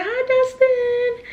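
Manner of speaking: very high, drawn-out squeal; excited fangirl greeting. Retold or imitated. Fan greets her idol, re-enacted excited and high. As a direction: re-enact a starstruck hello, stretched way up high. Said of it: "I was just so excited".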